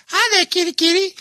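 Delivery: very high sing-song call to a cat; baby-talk to a kitty. Retold or imitated. A person calling a cat over, in very high baby talk. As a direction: coax the kitty in a squeaky high voice.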